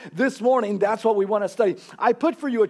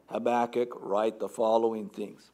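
a lower, softer voice of God; 9 semitones lower, 5 dB quieter than usual. Retold or imitated. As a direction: speak as God giving a quiet command, low and soft. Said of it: "an audible voice from God".

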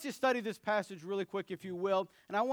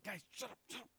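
hushed scolding, much softer; 14 dB quieter, faster than usual. Retold or imitated. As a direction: sister hushing the brothers, sleeping baby, hushed. Sister hushing them, baby asleep.

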